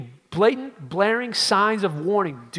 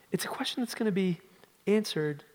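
ordinary talk, nothing marked

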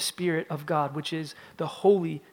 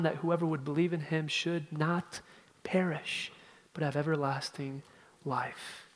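hushed whisper; 2 semitones lower, 7 dB quieter than usual. Real, not acted.

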